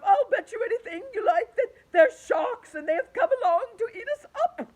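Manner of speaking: high, loud panicky wail; frightened cry certain of doom; fearful earthworm. A narrator's reading. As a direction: whimper the dread aloud. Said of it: "cried the Earthworm".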